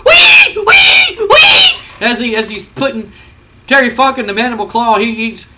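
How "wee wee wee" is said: very high, loud gleeful squeals; a wrestler squealing with glee over his victim; Mankind squeal. Retold or imitated. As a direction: shriek it like a giddy maniac. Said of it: "you can hear Mankind going".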